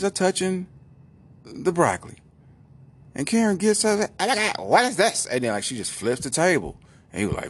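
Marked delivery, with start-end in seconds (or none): 4.2-5.3 high-pitched mock freak-out; sudden high outburst of mock outrage; acted outburst. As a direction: play an exaggerated outraged girlfriend. Retold girlfriend flips over dinner.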